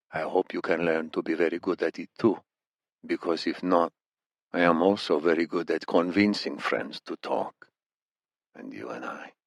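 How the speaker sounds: put-on thick Iranian accent, quietly threatening; Iranian captor.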